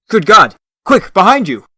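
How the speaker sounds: loud, high startled warning; alarmed shout. Acted. Mentor yelling a warning at the hero, alarmed shout. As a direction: yell the warning to save him.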